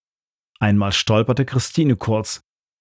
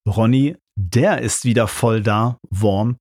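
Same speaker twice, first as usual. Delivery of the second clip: plain reading voice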